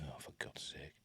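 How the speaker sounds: barely audible, under the breath; low, whispered grumble of frustration; exasperated mutter. Real, not acted. Presenter to himself as the skip fails, muttered exasperation.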